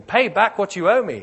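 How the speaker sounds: loud, high furious demand. Acted. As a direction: bark it at the debtor. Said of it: "he demanded".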